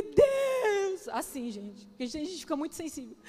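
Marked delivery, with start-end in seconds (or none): 0.0-1.1 drawn-out, higher-pitched cry to God; retold cry to God. Woman retelling her plea to God, crying out. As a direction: call out to God, stretching the word high.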